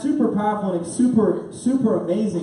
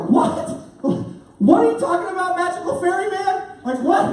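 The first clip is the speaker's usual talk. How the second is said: high, loud disbelief; incredulous; 12 semitones higher, 3 dB louder than usual. Retold or imitated. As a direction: blurt it out, astonished, pitched way up. Stunned by ticket man's news.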